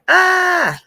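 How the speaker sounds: sudden high yelp of shock; startled yelp. Retold or imitated. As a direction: yelp like you got spooked. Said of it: "I was just like".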